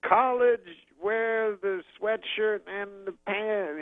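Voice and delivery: high, very slow comedian impression; Bill Cosby. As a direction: stretch out each word in a high imitation. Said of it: "Bill Cosby goes".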